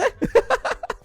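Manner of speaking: very high giggle; tickled by your own work; amused giggle. Real, not acted. Amused by his own drawings.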